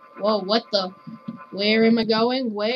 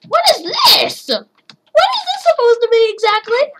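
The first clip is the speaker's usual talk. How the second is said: baffled high outcry; 9 semitones higher, 4 dB louder than usual. Real, not acted. Confused by game.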